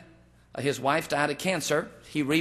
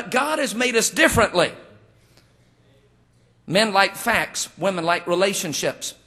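ordinary delivery

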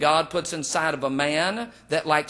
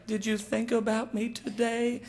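high, soft mock-feminine voice; needy woman; 5 semitones higher, 6 dB quieter than usual. Wife wanting attention from her husband, mock needy. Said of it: "That's why women say".

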